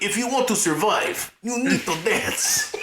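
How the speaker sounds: high, hammed-up German-Russian accent, slowing at the end; bad German-Russian accent. Podcaster retrying the doctor's line, bad German-Russian accent. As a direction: botch a Russian-tinged German accent, breaking into laughs. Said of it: "I'm not really good with that".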